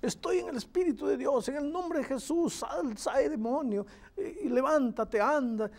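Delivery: high-pitched exorcist's commands; self-proclaimed miracle workers. Acted. Preacher imitates a group casting out demons, high commanding voice. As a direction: mimic a faith healer, pitched well up. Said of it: "un grupo de personas que decía".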